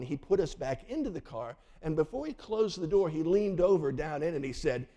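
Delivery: normal talking voice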